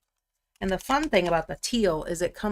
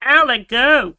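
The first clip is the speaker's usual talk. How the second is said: real high, loud "ow, let go"; pained yelp; 6 semitones higher, 11 dB louder than usual. Real, not acted. Streamer telling whatever grabbed them to let go, pained yelp. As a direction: yelp sharply to make it let go. Startled, tells something to let go.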